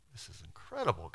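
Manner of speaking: awed whisper to himself. Acted. Joseph listening in secret, whispering in awe. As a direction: breathe it out in secret wonder. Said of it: "Joseph is just sitting there going".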